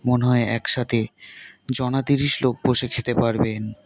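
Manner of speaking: usual speaking voice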